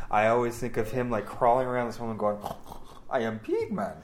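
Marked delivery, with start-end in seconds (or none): 3.1-3.9 lightly put-on voice of an over-the-top director; hint of a mock character voice; Tommy Wiseau. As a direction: announce yourself in a lightly odd put-on voice. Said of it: "when I think of Tommy Wiseau".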